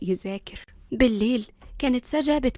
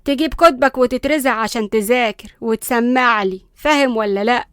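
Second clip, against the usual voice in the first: loud, bossy granny scolding; indignant grandmother, forceful; stern grandmother; 8 dB louder than usual. A narrator's reading. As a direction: overrule them and lay down the law.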